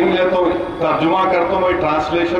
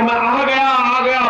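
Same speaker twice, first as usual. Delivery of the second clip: put-on child calling out; 6 semitones higher, slower than usual. A narrator's reading. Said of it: "पुकार कर".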